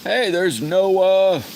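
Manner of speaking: very high, loud, slow sing-song hello; excited greeting. Real, not acted. Streamer spotting Noah in chat, greets him with a loud sing-song call. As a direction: holler a happy welcome.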